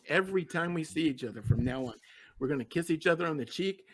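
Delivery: usual speaking voice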